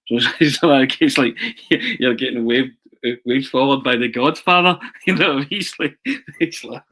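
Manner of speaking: high, loud laughing through words; giggling with delight; real laughter breaking in. Real, not acted. Laughing retelling the Godfather moment.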